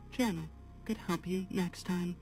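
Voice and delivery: normal talking voice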